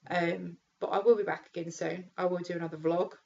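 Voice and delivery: ordinary delivery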